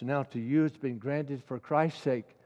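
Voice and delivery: normal talking voice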